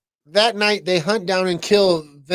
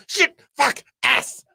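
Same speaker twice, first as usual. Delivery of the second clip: tic outburst; 8 semitones higher, slower than usual. Retold or imitated. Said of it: "I brought your fucking cologne".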